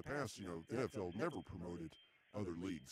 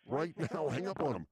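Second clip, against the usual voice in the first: louder, insistent yelling at the caller; host yells at the crew to cut the caller off; mock yelling; 3 semitones higher, 8 dB louder than usual. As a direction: demand he hang up right now.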